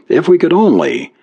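ordinary delivery, nothing marked

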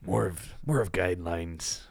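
put-on slight pirate accent, half-hearted; mock pirate accent. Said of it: "I like that accent".

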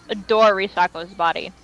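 normal talking voice

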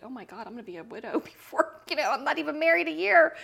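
rising, loud mock panic with a laugh; retold upset. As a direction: build to a high, loud outcry. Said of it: "but I was very upset".

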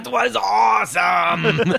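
high, slow gleeful yell; villain cheering as he runs out; mock gleeful shout.

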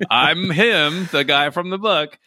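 mock impostor voice. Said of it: "she'd just be like".

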